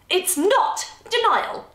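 high, slow, emphatic retort; barked. A narrator's reading. Easter denies her mother's accusation, barking it. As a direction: hit NOT hard, crossly. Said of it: "Easter barked".

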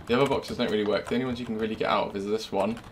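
ordinary talk, nothing marked

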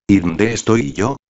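ordinary delivery, nothing marked

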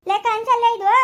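husband snapping at his wife that it is no dream; gruff scolding. Acted. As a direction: snap at her to wake up.

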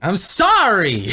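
drawn-out mock-pleading sorry; exaggerated apology. Streamer apologizes to teasing friends, slow exaggerated apology. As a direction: stretch the apology right out.

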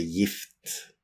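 gift with a soft J, jift, quiet. Shown as a demonstration. Teacher to learners, saying gift the Norwegian way. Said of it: "we pronounce it J I F T".

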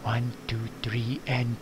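hushed sneaky whisper; very quiet. Pretend. Man plotting to sneak up on the cereal, hushed whisper. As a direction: whisper it like you're creeping up on something. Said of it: "What if we're very quiet".

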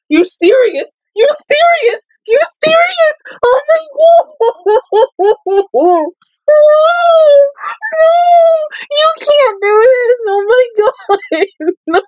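escalating high-pitched disbelief breaking into wails; drawn-out, high tearful pleading; distraught wailing. Real, not acted. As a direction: cry out in anguish, voice climbing very high. Distraught reaction to character's apparent death.

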